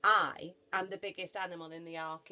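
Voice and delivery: loud, weighty emphasis on "I"; self-important, voice pushed out; boastful elephant. Acted. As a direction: announce your superiority as the biggest beast.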